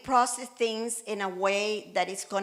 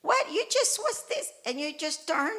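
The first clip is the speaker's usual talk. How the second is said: incredulous squeal; 8 semitones higher than usual. Retold or imitated. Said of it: "And I'm like".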